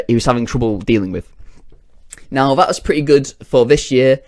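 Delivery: ordinary talk, nothing marked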